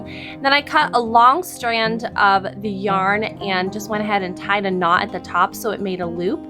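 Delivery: normal talking voice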